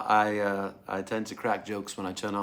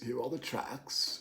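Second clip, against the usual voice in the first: softer repeat under the breath; much softer voice; hushed echo; 8 dB quieter than usual. Real, not acted. Hushed echo of own words.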